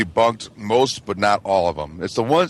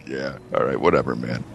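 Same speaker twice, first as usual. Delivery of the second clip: bored dismissal; 11 semitones lower, 4 dB quieter than usual. Retold or imitated. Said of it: "It's like".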